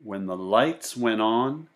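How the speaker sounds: lively read-aloud voice for a class; storybook narration. A narrator's reading. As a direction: read it to the kids, with bounce and wonder. Teacher reads picture book aloud.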